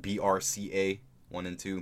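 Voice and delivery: "B R C A" letter by letter; spelled out. Shown as a demonstration. Host clarifying the gene name for listeners, B R C A spelled out. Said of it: "This is how we usually pronounce it".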